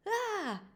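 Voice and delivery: high sharp gasp of fright; startled gasp. Acted. Father startled by a tap on the shoulder, high gasp. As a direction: gasp in surprise, pitched high. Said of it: "gasped his father".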